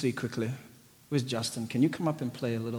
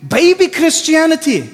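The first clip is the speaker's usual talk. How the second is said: loud, high, impassioned preaching; fired-up sermon voice; full-force preaching; 14 dB louder, slower than usual. Acted. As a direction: preach it out to the crowd.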